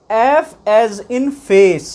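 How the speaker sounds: "F as in face" stretched slowly, word by word; slow pronunciation demo. Shown as a demonstration. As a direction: spell out "F as in face" slowly for learners. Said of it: "एफ में".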